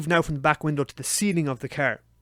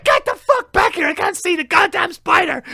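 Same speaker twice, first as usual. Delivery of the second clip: high, strained, drawn-out yell; frantic shouting; 13 semitones higher, 6 dB louder than usual. Retold or imitated. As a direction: holler for them to come back, desperate. Yelling after fleeing partner.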